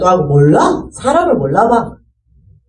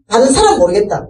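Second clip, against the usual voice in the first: ordinary talk, nothing marked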